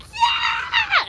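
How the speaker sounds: very high excited shout; kid's cheer. Retold or imitated. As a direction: squeal it like a thrilled kid. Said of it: "he's like".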